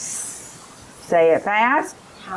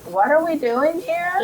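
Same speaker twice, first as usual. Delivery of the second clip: put-on confused child; 8 semitones higher than usual.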